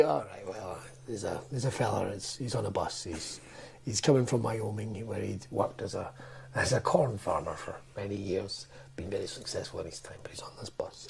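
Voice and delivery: put-on low, soft, fading to a whisper, old man dragging out a joke; lightly mocking an old storyteller who trails off into a whisper; rambling old joke-teller. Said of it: "how older people will tell a joke".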